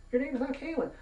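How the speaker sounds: high-pitched indignant protest; exasperated, pitched right up; indignant disbelief. Real, not acted. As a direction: call out the lie, voice shooting up. Ranting at agent's false name.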